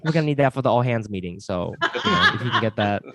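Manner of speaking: lightly voiced corporate colleague, polite and breezy; a hint of a manager chasing a deadline; office email voice. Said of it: "like it's a work email".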